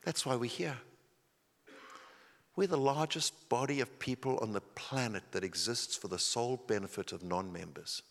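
hushed sincere sermon voice; quiet, earnest preaching. Acted. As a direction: draw them in close and gently. Quiet intimate sermon section.

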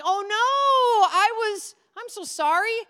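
high, loud, fake-tearful apology; overdone tearful grovelling; mock apology. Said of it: "I was like".

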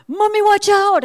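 high, loud imitation of a kid; shrieked mock-child call to mom; excited child shout. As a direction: yell a warning like an excited little boy. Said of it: "in his excitement".

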